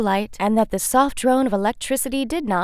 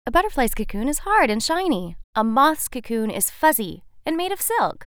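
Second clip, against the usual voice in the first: butterfly refusing the moth, higher and haughty; 5 semitones higher than usual. Acted. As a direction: turn up your nose at him. Butterfly character voice.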